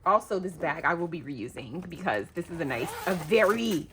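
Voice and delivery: ordinary talk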